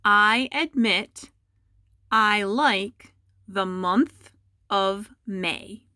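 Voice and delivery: slow, word-by-word dictation; careful slow dictation to students. Shown as a demonstration. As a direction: read it out slowly so students can write. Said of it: "and I'll say it a few times".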